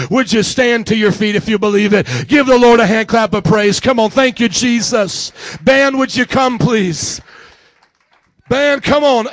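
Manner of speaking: loud, projected call to the congregation; real preacher's rallying shout; rousing altar call. Real, not acted. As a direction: bring the whole room to its feet. Calls congregation to stand and applaud.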